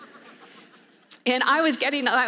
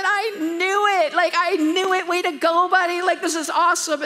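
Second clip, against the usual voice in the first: high, loud cheering; mock-thrilled praise; excited mom; 6 semitones higher, 4 dB louder than usual. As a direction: cheer your son on. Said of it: "And I said".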